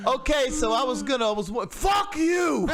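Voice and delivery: high, loud mock shout; shouty caricature of the band member; angry metal singer. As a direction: bark it at the interviewer like a furious rocker. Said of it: "he's like".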